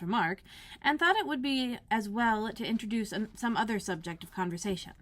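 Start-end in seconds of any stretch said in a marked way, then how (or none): none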